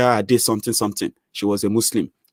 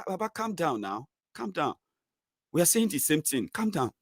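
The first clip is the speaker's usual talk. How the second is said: much softer, gentle calming; soothing; 6 semitones higher, 10 dB quieter than usual. Retold or imitated. Calming the angry Baba, soft and soothing. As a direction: hush him down softly.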